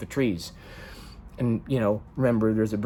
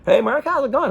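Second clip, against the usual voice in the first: high loud friendly hello; breezy bartender greeting; cheery bartender; 14 semitones higher, 5 dB louder than usual. Retold or imitated. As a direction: call out like a bartender. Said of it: "stranger bartender said".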